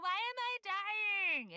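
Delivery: high, slow hammed-up wail; overplayed allergy despair; mock dramatic wail. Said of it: "Every year I'm like".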